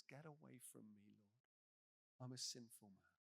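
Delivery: low, hushed acted confession; humbled confession. Simon kneeling before Jesus after the catch, humbled confession. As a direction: say it softly, as if on your knees.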